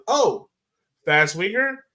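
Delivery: normal talking voice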